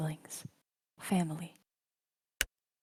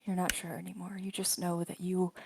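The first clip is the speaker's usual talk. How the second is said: ordinary talk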